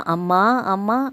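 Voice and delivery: understated cry for mother, rising at the end; a child calling out for amma, mildly wailed; child's wail. A narrator's reading. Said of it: "அவன் கதறினான்". (குழந்தையின் மெல்லிய கதறல்)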